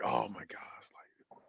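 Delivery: hushed, voiceless mock awe; awed whisper. Gamer finally getting the game, awed whisper. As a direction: whisper it as if overwhelmed.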